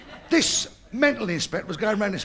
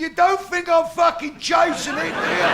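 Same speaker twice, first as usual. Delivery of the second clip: loud, laughing shout; shouted punchline; 10 semitones higher, 6 dB louder than usual. Acted. Geezer yells back at fella, loud. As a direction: belt out the punchline.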